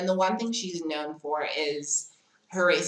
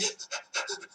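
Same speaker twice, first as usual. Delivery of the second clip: imitated crying gasp; 10 dB quieter than usual. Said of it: "I'm very much still like".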